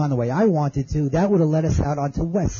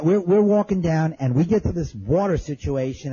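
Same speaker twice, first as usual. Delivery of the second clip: usual speaking voice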